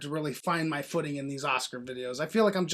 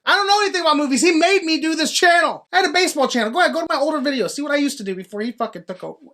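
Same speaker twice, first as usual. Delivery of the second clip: raised, agitated outburst, easing at the end; ranting breakdown; 8 semitones higher, 4 dB louder, faster than usual. Real, not acted. Defending himself to the co-host, worked-up rant. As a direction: blurt it out high and loud, then wind down. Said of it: "you are seeing a mental nervous breakdown".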